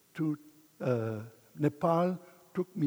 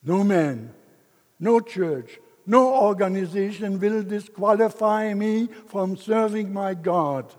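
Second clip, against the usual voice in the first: louder, firm declaration; defiant vow; 3 semitones higher, 7 dB louder than usual. Retold or imitated. Man vowing no one will disqualify him. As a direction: stand up and proclaim it.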